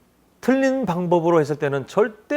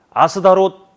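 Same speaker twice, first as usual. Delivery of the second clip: normal talking voice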